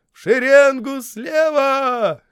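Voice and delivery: high, slow, loud parade command; shouting drill sergeant. A narrator's reading. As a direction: drag it out across the parade ground. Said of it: "долетел издалека чей-то голос". (протяжная строевая команда)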